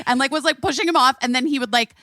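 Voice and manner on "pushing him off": ordinary talk, nothing marked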